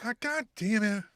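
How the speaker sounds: high then fading, a laugh at the end; mock defeated groan. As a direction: grumble like a sore loser.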